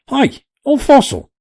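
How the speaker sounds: high, loud rough yell. A narrator's reading. Sailor yelling after the departing professor. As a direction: holler after the old man. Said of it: "cried the man".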